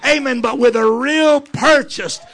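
high, loud, ringing sermon climax; swelling revival preaching with shouted hallelujahs; full-force preaching. Acted.

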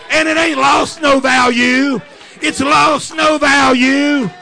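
high, loud, ringing sermon climax; swelling revival preaching with shouted hallelujahs; full-force preaching. Acted.

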